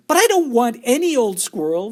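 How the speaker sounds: high loud bratty whine; shout. A narrator's reading. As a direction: yell it like a spoilt girl. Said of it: "Veruca shouted".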